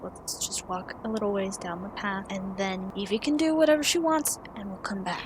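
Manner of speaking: hushed, conspiratorial undertone. Acted. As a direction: keep it down so only the group hears. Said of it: "He kinda lowers his voice".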